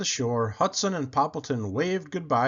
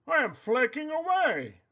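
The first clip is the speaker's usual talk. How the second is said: Poppleton voice; 11 semitones higher than usual. A narrator's reading.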